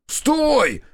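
screaming stop at the driver; yelling. A narrator's reading. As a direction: bellow at the passing car. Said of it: "заорал я".

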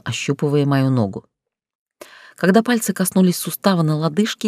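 plain reading voice

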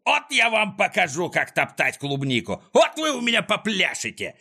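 high-pitched furious threat; outraged villain holler; threatening yell. A narrator's reading. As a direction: scold the tramplers with menace. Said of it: "заорал он".

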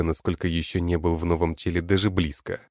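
ordinary delivery

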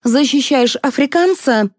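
high-pitched, pressing order; commanding. A narrator's reading. Liza assigning the showman his role, commanding. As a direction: give it as an order. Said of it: "приказала Елизавета".

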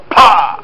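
spoken smack of an impact; a single percussive crash noise; imitated crash. Preacher miming a crash against a wall, percussive impact.

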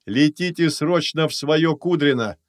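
loud, high, cheerful calling; joyful booming voice. Acted. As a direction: boom it out gladly. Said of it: "радостный зычный голос Люборы". (радостно и зычно)

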